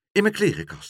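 put-on pressing little-girl reply; urgent child. A narrator's reading. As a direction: give a hurried hiding order. Child urgently directs hidden dove.

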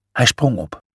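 plain reading voice

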